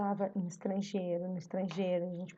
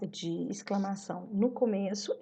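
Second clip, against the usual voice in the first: ordinary talk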